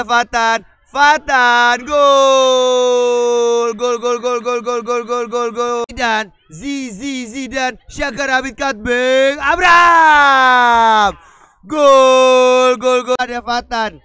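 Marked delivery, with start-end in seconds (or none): 0.9-5.8 goal shout fading to chant; goal call. Real, not acted. Commentator calls a goal. 9.4-13.2 slow drawn-out loud name shout; goal call. Real, not acted. Commentator calls a goal.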